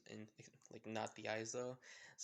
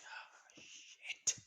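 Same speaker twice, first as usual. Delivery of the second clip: whispering. Acted. Izuku arrives at camp.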